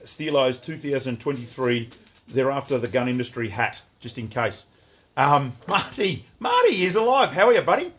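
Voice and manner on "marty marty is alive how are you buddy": loud, high-pitched hail; excited greeting. Real, not acted. Streamer welcoming a buddy back, loud excited greeting. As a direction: call out in delight at a mate turning up. Delighted greeting of a returning viewer.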